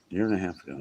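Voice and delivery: murmured repeat; quiet repeat. Real, not acted. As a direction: repeat "A year and a half ago" to yourself, quietly.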